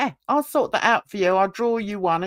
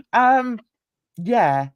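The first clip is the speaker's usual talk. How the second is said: ordinary talk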